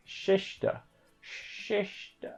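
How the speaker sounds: whispered attempt at Shishta. Shown as a demonstration. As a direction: hiss out Shishta trying the anime lisp. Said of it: "It doesn't make that noise".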